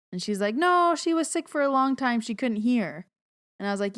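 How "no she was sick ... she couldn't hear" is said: put-on high, insistent old grandma voice; high voiced elderly grandma objecting; grandma insisting. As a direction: push back like a stubborn grandma.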